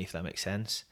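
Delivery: usual speaking voice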